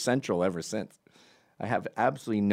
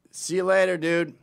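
called-out goodbye; 8 semitones higher, 6 dB louder than usual. Retold or imitated. Calling goodbye down the street.

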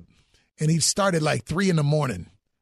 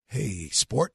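lower, softer gentle dad voice; a low, quiet father calling his son; imitating dad; 6 semitones lower, 4 dB quieter than usual.

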